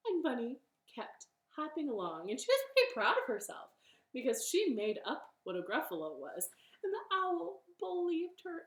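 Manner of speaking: ordinary delivery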